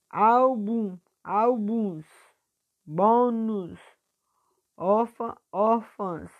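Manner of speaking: high, loud first syllables in "Álbum, álbuns. Bônus, órfã, órfãs"; teacher sustaining each first syllable in "Álbum, álbuns. Bônus, órfã, órfãs"; stretched stressed syllable. Shown as a demonstration. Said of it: "puxando mais a sílaba forte".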